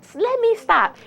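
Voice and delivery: high-pitched retold double take; startled reaction. Vlogger recalls hearing the news walking out, startled reaction. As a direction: stop short in high-voiced surprise. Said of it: "I'm like".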